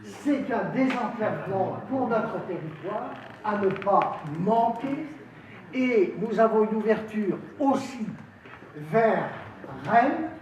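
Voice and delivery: high, loud oratory in separated phrases; rousing political speech. Real, not acted. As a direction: proclaim it to the hall, pausing between phrases. Councillor delivers a formal speech.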